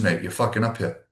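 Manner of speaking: ordinary talk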